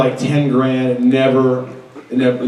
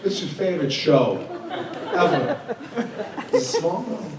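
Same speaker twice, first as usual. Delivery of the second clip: halting, understated celebrity impression; deadpan impression with stop-start phrasing; Christopher Walken. Shown as a demonstration. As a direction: break the phrases in odd places, as the actor does. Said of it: "All right, there's the Walken for you".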